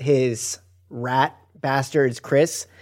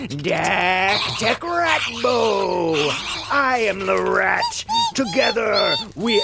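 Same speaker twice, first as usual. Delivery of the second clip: slow, raised-pitch chanting, each word drawn out; powerful incantation; 5 semitones higher, much slower than usual. Acted. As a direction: intone the spell grandly, building to a cry. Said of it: "I'm gonna whisper one powerfully".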